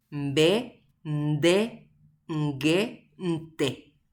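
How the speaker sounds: "Mbe, nde, nge, nte" each syllable said slowly and apart, with the nasal onset sounded before the stop; teacher sounding "Mbe", "nde", "nge", "nte" for the class, nasal onset before each stop; nasal consonant demo. Shown as a demonstration. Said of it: "Ha upea ha'e hína".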